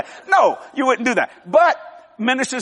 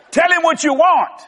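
high, raised-voice exhortation; rousing shout; 7 semitones higher, 4 dB louder than usual. Real, not acted. Preacher urging wives in the audience, rousing shout. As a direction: cry it out to rally the crowd.

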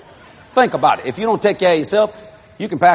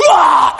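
mock scream; 9 semitones higher, 7 dB louder than usual. Possessed woman's cry retold.

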